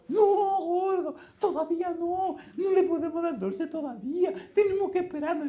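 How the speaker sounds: husband voicing his wife forbidding sweets; mock wife scolding. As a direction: play the wife fussing, high-pitched. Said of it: "qué lío se armó".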